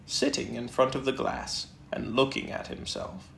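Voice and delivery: ordinary delivery, nothing marked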